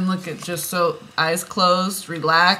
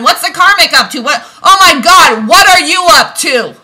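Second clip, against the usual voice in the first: louder, higher, exasperated yelling at viewers; angry rant; 7 semitones higher, 11 dB louder than usual. Real, not acted. Reader scolding divine feminine viewers for watching readings, angry shout. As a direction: let the frustration boil over into shouting. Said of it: "I'm getting angry".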